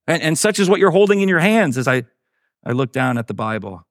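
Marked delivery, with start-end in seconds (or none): 0.0-1.8 high, keen storybook voice; eager prompting. A narrator's reading. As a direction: prompt your sister eagerly, pitched high. Betsy eagerly continues her list.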